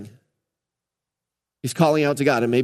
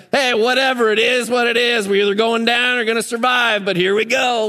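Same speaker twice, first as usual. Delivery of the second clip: laissez-faire shrug; 5 semitones higher than usual. Retold or imitated. Helmsman shrugging at the storm, carefree and easygoing. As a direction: go with the flow, come what may. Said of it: "laissez-faire".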